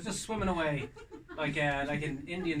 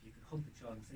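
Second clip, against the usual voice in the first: ordinary delivery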